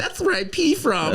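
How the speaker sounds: high, slow, laughing mock protest. Retelling his teenage reply.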